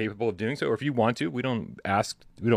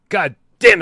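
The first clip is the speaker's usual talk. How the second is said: sudden loud God damn; loud exclamation; 9 semitones higher, 11 dB louder than usual. Real, not acted. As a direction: blurt it out, exasperated. Outburst mid intro.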